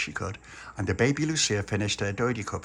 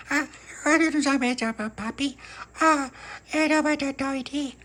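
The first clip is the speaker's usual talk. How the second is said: put-on very high squeaky toddler voice; baby voice. Baby Lucia answering little pappy, squeaky and high. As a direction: talk like a tiny child.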